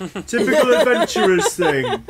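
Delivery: high, loud scornful mushroom voice; high, loud sneering fungus; scoffing mushroom. Acted. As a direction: scoff at adventurers. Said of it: "someone else goes".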